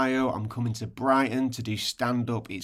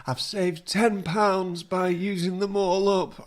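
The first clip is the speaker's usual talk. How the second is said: mocking co-host; 8 semitones higher than usual. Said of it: "how is he using this time off".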